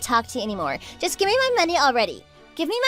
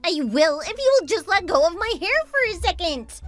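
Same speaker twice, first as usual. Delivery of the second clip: whining; 10 semitones higher than usual. Acted. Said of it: "stop whining already".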